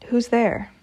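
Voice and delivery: cried out. A narrator's reading. Baba Yaga challenging a hidden human, cried out. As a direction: call out to find the intruder. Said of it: "she cried".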